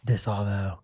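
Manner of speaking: low, soft Aussie mimicry. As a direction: drop into a quiet mock Australian voice. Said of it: "I like the deeper".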